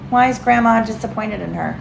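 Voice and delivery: plain reading voice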